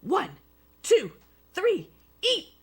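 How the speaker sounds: loud, high countdown shout; yelled. A narrator's reading. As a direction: call the start of the contest. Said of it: "he yelled".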